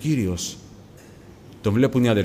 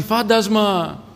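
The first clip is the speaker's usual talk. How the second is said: high, drawn-out mock cry of alarm; long high wail, acted out; retold frightened cry; 8 semitones higher, much slower than usual. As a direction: imitate someone calling out in fright, stretching the word. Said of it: "Αρχίζει και φωνάζει".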